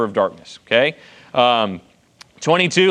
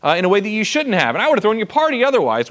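higher, pressing tone of a wronged father-in-law; mock indignant rant listing grievances; aggrieved accusation; 7 semitones higher than usual. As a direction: jab each charge at Jacob. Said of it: "proven a point of saying".

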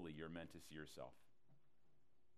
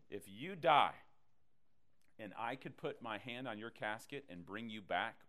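usual speaking voice

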